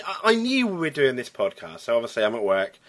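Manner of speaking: ordinary talk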